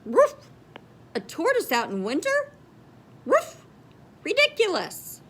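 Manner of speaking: loud, high barking dog voice; a cheeky dog jeering; mocking dog bark. Dog character mocking tortoise.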